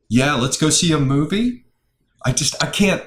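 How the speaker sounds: a quick, hammed-up attempt at a questioning young voice; mock millennial uptalk. Shown as a demonstration. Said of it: "I can't even do it".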